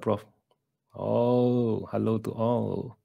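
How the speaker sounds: normal talking voice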